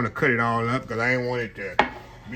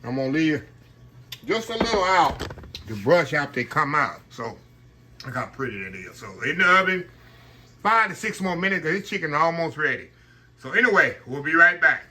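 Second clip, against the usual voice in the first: normal talking voice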